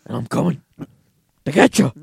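loud, building wrestling promo; wrestling promo shout. Acted. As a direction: growl it like a heel wrestler. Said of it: "sound a little bit like a wrestling promo".

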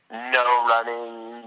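"no running" stretched out very slowly; drawn-out emphasis. Real, not acted. Emphasising where he started from.

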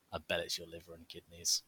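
ordinary talk, nothing marked

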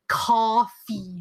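"coffee" with a long, heavy aw in the first syllable; exaggerated imitation. Teasing partner about his accent, drawn-out "coffee". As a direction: stretch the first vowel of "coffee". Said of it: "even John Verney says it".

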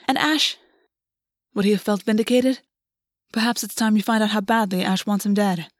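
ordinary delivery, nothing marked

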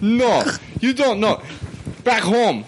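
hammed-up Russian tough guy; Russian accent. Friend taunting in the accent game, mock Russian accent. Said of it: "Russian, Russian".

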